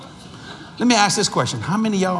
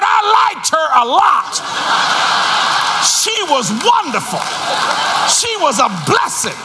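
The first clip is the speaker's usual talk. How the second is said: teasing husband; 10 semitones higher, 4 dB louder than usual. Retold or imitated. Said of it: "I said".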